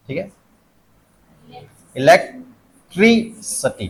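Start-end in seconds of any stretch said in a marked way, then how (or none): 2.0-3.9 "electricity" very slowly as e-lek, TRI, suh-tee; syllable-by-syllable demo. Shown as a demonstration. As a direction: stretch ट्रि and end on a short "suh-tee" in "electricity". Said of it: "अब ये सटी है". ("Electricity" धीरे, टुकड़ों में, अंत में सटी)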